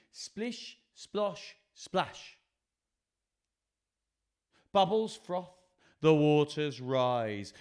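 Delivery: rhythmic storytelling with big pitch swings; lively picture-book narration. A narrator's reading. Reading lion's splashy bath to children, lively and rhythmic. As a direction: read with bounce, punching the sound words.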